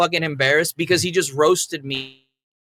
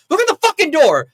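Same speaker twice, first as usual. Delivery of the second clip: high, loud shouted rebuke; retold furious yell; 12 semitones higher, 7 dB louder than usual. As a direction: imitate him yelling in outrage. Acting out leader yelling about door.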